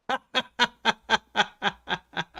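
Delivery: high, steady staccato laugh; real laugh. Real, not acted. Player amused by the keyboard discovery, real laugh. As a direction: burst into a long chuckle.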